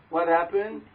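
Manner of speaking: normal talking voice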